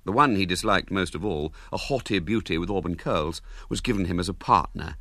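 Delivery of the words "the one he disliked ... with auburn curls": usual speaking voice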